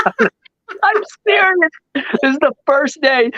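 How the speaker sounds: high, squealing through real laughter; laughing disbelief. Real, not acted. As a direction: insist through a fit of laughter. Laughing in amazement at own story.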